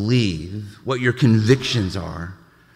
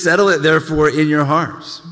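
lifted, louder reading of the verse; scripture reading; 5 semitones higher, 6 dB louder than usual. Acted. Pastor proclaiming scripture to the congregation, raised.

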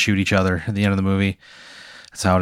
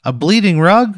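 nervous pitchman; 8 semitones higher, 4 dB louder than usual. Acted. S0 still voicing Kevin.

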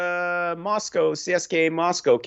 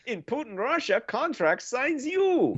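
put-on Russian accent; 13 semitones higher, slower than usual. Yakov Smirnoff style joke.

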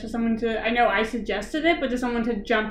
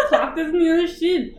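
laughing New Zealand accent impression; higher-pitched imitated New Zealand accent; 5 semitones higher than usual. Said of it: "That was exactly what she said".

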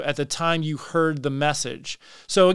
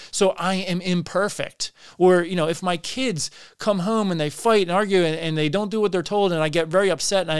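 normal talking voice

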